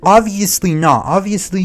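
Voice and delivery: loud, exasperated rant at the streamer; frustrated outburst. Real, not acted. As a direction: blast him with disbelief. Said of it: "it makes me frustrated".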